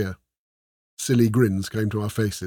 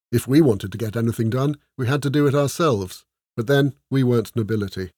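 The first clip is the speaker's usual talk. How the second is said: plain reading voice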